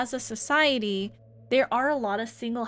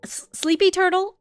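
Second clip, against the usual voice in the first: excited call-out; 8 semitones higher, 8 dB louder than usual. Real, not acted. Calling out chat name.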